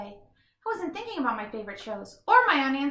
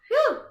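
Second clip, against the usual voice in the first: relieved whew; 14 semitones higher, 7 dB louder than usual. Acted. Flops back on the couch.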